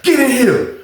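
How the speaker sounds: high called-out summons; mock yelled call. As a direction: yell for him to come in. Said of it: "He was like".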